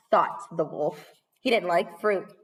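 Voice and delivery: plain reading voice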